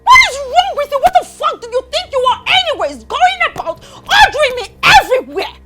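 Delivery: loud, high-pitched screaming defiance; furious tirade. Acted. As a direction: yell it, refusing to be bossed around. Tirade against being ordered around.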